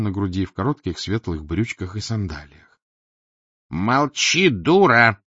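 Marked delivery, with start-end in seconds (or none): 3.7-5.2 louder rude tenor; snapping tenor. Acted. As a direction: cut her off harshly. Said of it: "посоветовал деловитый тенор". (грубый тенор)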